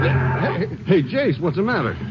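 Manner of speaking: jolted, asking what's wrong; startled call. Acted. Partner startled when the car stops short, calling out high and alarmed.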